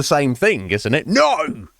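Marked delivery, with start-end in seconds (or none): 1.1-1.7 high, yowled caricature of refusal; mock stubborn refusal. Hothead refusing a conversation, mock-outraged.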